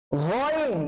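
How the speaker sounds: "গইন" drawn out from the throat; long, throaty "গইন"; throat letter. Shown as a demonstration. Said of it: "গইনটা কিন্তু basically কণ্ঠনালী থেকে উচ্চারণ করতে".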